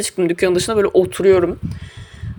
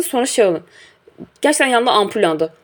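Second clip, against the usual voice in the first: usual speaking voice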